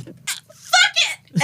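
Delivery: high, laughing all-in yell; reckless battle cry. Acted. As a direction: throw caution away with a giddy shout. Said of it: "Girly just goes".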